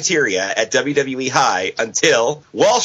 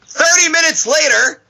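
loud, much higher shouted caption; all caps reading; 12 semitones higher, 4 dB louder than usual. Shown as a demonstration. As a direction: bellow it like all caps text. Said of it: "I gotta shout that part again".

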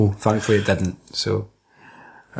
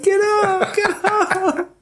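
high-pitched mock girl's voice; slower than usual. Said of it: "she's like".

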